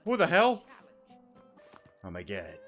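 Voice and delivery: normal talking voice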